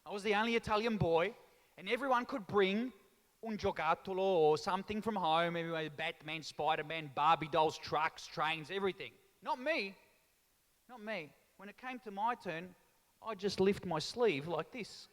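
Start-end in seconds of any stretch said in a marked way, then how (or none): none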